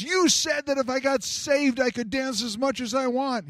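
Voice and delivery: mad woman complaining. Retold or imitated. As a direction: play the offended churchgoer scolding him. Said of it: "just as mad as can be at him".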